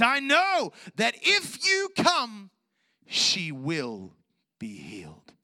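high slow cry falling to a whisper; ringing shout that sinks into hushed certainty; fervent declaration of faith. Retold or imitated. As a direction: shout it out, then hush the last words. Acting Jairus's declaration of faith.

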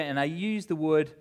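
ordinary talk

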